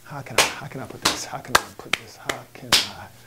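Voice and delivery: very soft, low, half-whispered; muttering to himself. Acted. Host searching how to put it, muttering. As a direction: mutter while hunting for tactful words.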